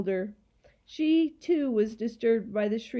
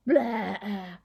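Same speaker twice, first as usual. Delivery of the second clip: taunting; slower than usual. A narrator's reading. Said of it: "it said, sticking its tongue out".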